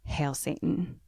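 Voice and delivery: very soft, understated, almost under the breath; quiet solemn utterance. Retold or imitated. Alex lifting the dagger over her, quiet utterance. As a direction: say it quietly, apart from the chanting. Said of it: "and he's doing nothing".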